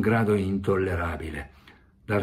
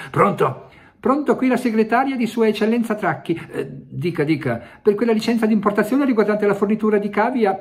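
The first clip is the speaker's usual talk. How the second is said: much higher, fast officious secretary voice; fast, high voice of a minister's secretary; brisk secretary on the phone; 13 semitones higher, 5 dB louder, faster than usual. Acted. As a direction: rattle it off like a busy secretary calling. Secretary on the telephone.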